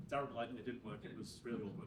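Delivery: low, soft, embarrassed aside; deflated. Acted. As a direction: drop right down after an awkward failure. Deflated after the failed laugh.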